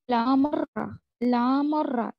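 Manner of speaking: लामर्रा read as Tajweed example; Quranic recitation demo. Shown as a demonstration. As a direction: recite लामर्रा with no nasal hum, r joined. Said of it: "जब भी आपको ... लाम दिखे या".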